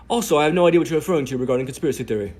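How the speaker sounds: loud, fast mock British reporter; mock British accent. Reporter's DM read fast in a mock British accent. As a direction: rattle it off in a hammed-up British accent. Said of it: "I'm doing her British accent".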